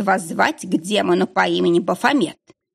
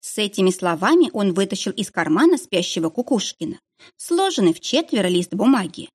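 ordinary delivery

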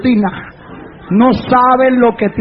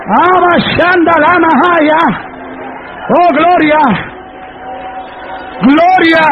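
fervent preaching praise; 5 semitones higher, slower than usual. Acted. Preacher breaking into praise before the church, fervent cries. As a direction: cry out each praise with rising force.

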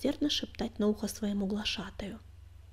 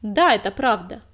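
proud; 8 dB louder than usual. A narrator's reading. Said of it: "гордо вскинув подбородок".

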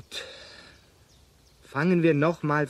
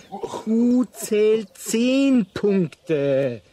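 slow, spelled out; 3 semitones higher, 4 dB louder, much slower than usual. Acted. Arthur teaching cavemen Scrabble.

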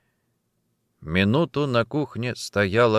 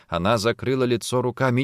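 usual speaking voice

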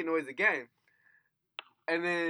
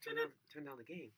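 quiet request; 3 semitones lower, 15 dB quieter than usual. Retold or imitated. Retells asking Max during late night.

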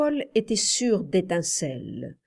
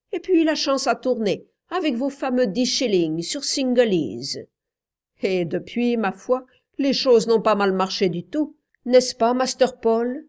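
raised, lively voice of a storyteller servant; chatty Bassett, respectful; 4 semitones higher, 4 dB louder than usual. Acted. Bassett telling the uncle about their bets, chatty. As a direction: recount it heartily, pitched up a little.

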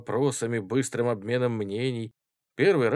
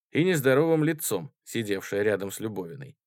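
usual speaking voice

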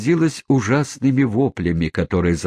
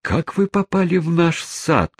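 high-pitched flustered maid; lightly acted confused servant voice; bewildered babble; 9 semitones higher than usual. A narrator's reading. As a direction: ask it up high, at a loss. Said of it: "растерянно пролепетала горничная".

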